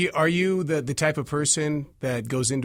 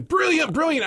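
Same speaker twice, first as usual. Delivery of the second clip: much higher, loud gushing; gushing praise; 13 semitones higher, 5 dB louder than usual. Retold or imitated. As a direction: rave in a high swoon. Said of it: "everybody's going".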